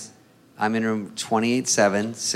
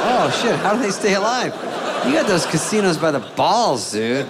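high, laughing disbelief; laughing amazement; 8 semitones higher than usual. Retold or imitated. To the gambling buddy, laughing high amazement.

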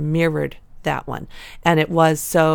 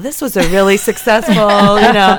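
loud, higher, elated exclamation; stoked; 4 semitones higher, 10 dB louder than usual. Retold or imitated. Third-time mama telling how stoked she was, excited. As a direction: retell it thrilled and loud. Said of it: "I was stoked".

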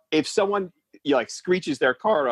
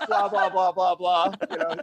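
ordinary delivery

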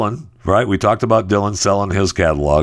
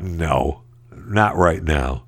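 ordinary delivery

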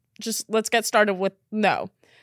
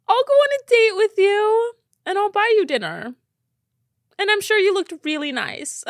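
higher, louder, pleading; comforting; 10 semitones higher, 5 dB louder than usual. Real, not acted. Promising the short guy a date, high pleading comfort. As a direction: offer it like a hug.

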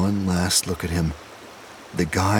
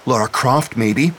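snapped; 6 semitones higher, 4 dB louder than usual. A narrator's reading. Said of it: "snapped Dumont".

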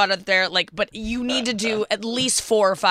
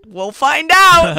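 teasing threat; 9 semitones higher, 11 dB louder, much slower than usual. Retold or imitated. Acted quote, loud slow.

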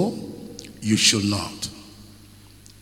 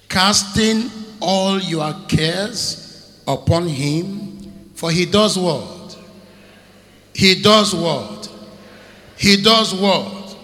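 slow, louder each time on "does"; building preached question; 4 semitones higher, 6 dB louder, slower than usual. Acted. Preacher calling for the crowd to answer. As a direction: press the congregation for the answer.

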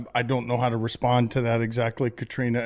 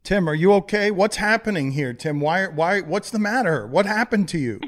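higher-pitched, urgent questioning; alarmed concern; 6 semitones higher, 3 dB louder than usual. Acted. Host to injured caller on the phone, alarmed concern. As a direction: press him anxiously for answers.

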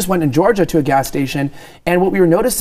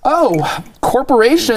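higher-pitched, hammed-up "oh"; mock sudden realization; 7 semitones higher, slower than usual. Host mocking Biden's sudden libertarian turn, mock realization. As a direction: put on a feigned change of heart. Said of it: "suddenly Biden goes from far left to libertarian and is like".

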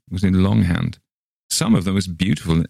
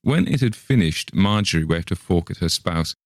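ordinary delivery

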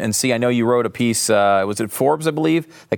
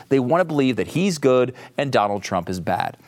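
ordinary delivery

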